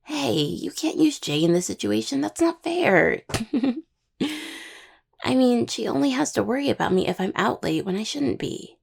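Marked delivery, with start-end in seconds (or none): 2.2-3.2 high, drawn-out, louder protest; playful protest. Acted. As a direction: protest in a high, stretched-out wail. Playful protest at being teased.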